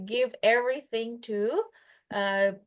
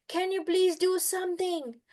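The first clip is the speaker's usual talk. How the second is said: a higher, gently begging cow voice; pleading cow; 7 semitones higher than usual. Retold or imitated. Cow begs the sage for help, higher pleading voice. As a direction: ask for help in a higher small voice. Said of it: "It says, like".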